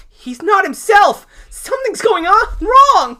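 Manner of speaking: very high, loud panic; alarmed cry. Acted. Velma warns Jerry as Kramer advances, alarmed. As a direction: call out a warning, panicking.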